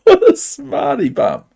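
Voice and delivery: high, loud, laughing jeer; laughing self-mockery. Real, not acted. Player mocks himself after a blunder, laughing self-mockery. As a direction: jeer at yourself through laughter.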